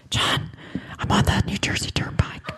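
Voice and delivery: urgent secret whisper into the phone. Retold or imitated. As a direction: whisper it fast so the driver won't hear. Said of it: "whispering fervently".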